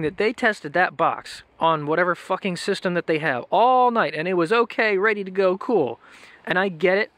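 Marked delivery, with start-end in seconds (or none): none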